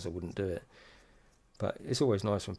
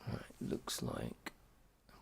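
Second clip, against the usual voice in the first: whispering; 12 semitones lower, 15 dB quieter, slower than usual. Real, not acted. Unvoiced muttering while fitting.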